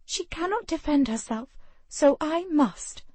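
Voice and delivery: a hint of a vow; resolute young woman. A narrator's reading. Ravenna resolute.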